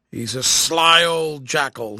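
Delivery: put-on rough, slow old tramp voice; gruff old soldier. A narrator's reading. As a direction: growl it slowly like a rough vagabond. Corporal character voice.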